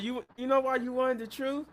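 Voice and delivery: high-pitched mock confrontation; aggressive, retold. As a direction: imitate someone turning on a friend. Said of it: "She's getting aggressive".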